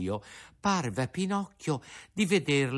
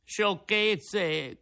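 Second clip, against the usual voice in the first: high sharp mock shout; 9 semitones higher than usual. A narrator's reading. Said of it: "gridò Pinocchio". (grido sprezzante, leggero)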